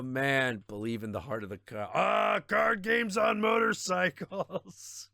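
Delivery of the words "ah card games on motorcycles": slow, high, hammed-up quote voice; mock quote. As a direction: drag out the meme line theatrically. Quoting a meme line.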